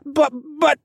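high, loud repeated BUT; stammering protest. A narrator's reading. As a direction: protest haltingly like a child. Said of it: "he stammered".

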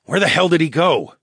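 snarling. A narrator's reading. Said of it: "I snarled".

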